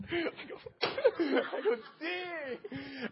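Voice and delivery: high laughing "Dang"; chuckling at the size of the ask; laughing disbelief. Retold or imitated. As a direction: laugh it out in amused shock. Laughing at thousand dollar request.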